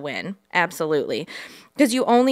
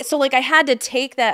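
ordinary delivery

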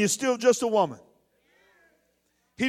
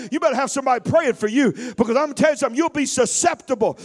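normal talking voice

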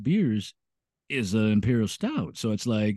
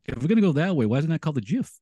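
ordinary talk, nothing marked

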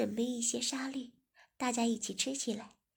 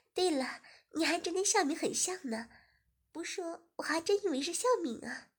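put-on higher, lively young woman teasing; young woman's voice; 6 semitones higher, 4 dB louder than usual. Guest Ziying teasing the host, higher and lively. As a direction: brighten up as the playful guest.

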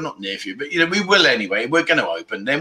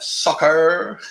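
stretched-out mock American soccer; bad American accent. Shown as a demonstration. Showing off his soccer to viewers, bad American accent. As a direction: exaggerate the American r, badly. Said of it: "Probably the worst American accent".

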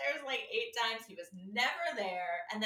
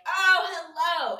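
much higher and louder, drawn out slowly; big cheery hello; 8 semitones higher, 12 dB louder, much slower than usual. Retold or imitated. Greeting the delivery man at the door, loud and sing-song. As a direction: throw the door open with a booming greeting.

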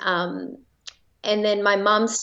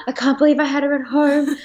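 overjoyed disbelief; 6 semitones higher, 4 dB louder than usual. Retold or imitated. Overjoyed after home birth.

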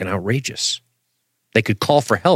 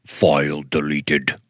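slow, low monotone robot; 6 semitones lower, slower than usual. Retold or imitated. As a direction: drag it out like a machine. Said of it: "And the robot voice goes".

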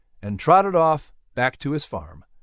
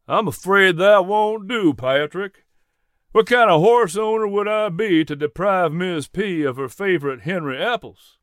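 higher-pitched Southern gentleman, playfully refusing; kindly horse owner, amused; 4 semitones higher than usual. Acted. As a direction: play a genial Southern farmer. Jack's character voice in story.

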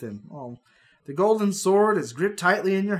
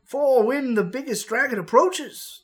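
put-on urgent old wizard; 7 semitones higher, 4 dB louder, slower than usual. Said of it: "Merle cries".

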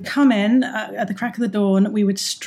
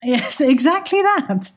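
laughing; 7 semitones higher than usual. Real, not acted. Laughing agreement.

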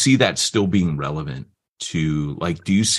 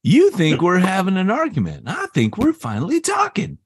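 high-pitched, exclaiming movie-quote voice; Rod Tidwell; 10 semitones higher than usual. Acted. Movie character insisting they are finally talking, high and excited. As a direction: quote the film line with pitch soaring. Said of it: "That's Rod Tidwell".

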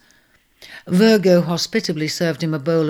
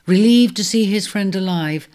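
ordinary delivery, nothing marked

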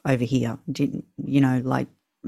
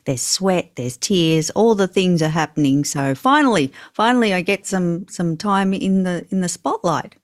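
usual speaking voice

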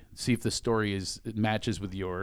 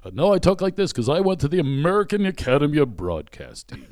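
louder, puffed-up DJ commercial read; radio commercial voice; 4 semitones higher, 6 dB louder than usual. Retold or imitated. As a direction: sell it like a cheesy radio ad. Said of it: "so I would do the D J voice".

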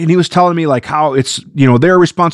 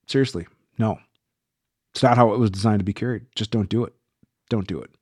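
much softer, weary disbelief; low, softer pleading with viewers not to do it; quiet exasperation; 5 semitones lower, 8 dB quieter than usual. Real, not acted. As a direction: drop to a tired hush of disapproval. Exasperated over unsafe carry.

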